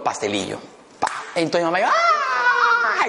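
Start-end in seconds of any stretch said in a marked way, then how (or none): 1.9-3.1 high scream of pain from a stingray sting; mom's scream. Retold or imitated. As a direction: let out a sharp high cry, half laughing. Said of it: "Gritó mi mamá". (grito agudo de dolor imitando a la mamá)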